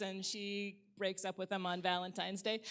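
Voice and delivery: usual speaking voice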